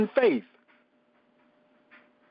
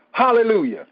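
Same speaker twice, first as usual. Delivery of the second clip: usual speaking voice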